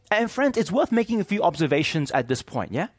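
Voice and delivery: ordinary talk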